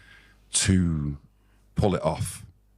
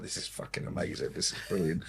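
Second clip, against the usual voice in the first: gleeful whisper; 8 dB quieter than usual. Retold or imitated. Band gleeful backstage, hearing by phone.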